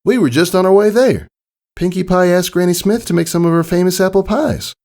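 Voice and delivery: cheerful country pony telling good news; friendly farm mare. Acted. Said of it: "she added".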